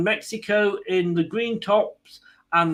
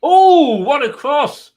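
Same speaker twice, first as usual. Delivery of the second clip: much higher and louder, thrilled; excited shout; 11 semitones higher, 11 dB louder, slower than usual. Real, not acted. Fan watching Mexico's cross live, a sudden excited shout. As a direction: yell it as the cross flies in.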